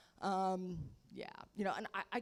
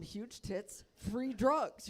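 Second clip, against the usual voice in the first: normal talking voice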